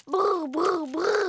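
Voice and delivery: truck noise. Acted. Child imitating a truck.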